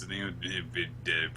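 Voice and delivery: normal talking voice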